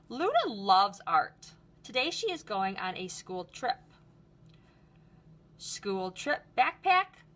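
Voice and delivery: lively storybook read-aloud; reading to children. Acted. Teacher reading Luna's story to the class, lively. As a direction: read it brightly for a class of kids. Reads picture book aloud.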